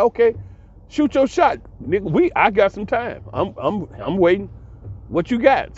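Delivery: taunting challenge. Retold or imitated. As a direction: goad him to try his best shot. Retold taunt of online rival.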